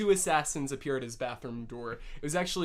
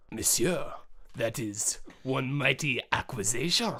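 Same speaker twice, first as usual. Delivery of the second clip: French; 4 semitones lower than usual. Acted. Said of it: "Madame de Gaulle goes".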